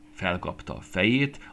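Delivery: usual speaking voice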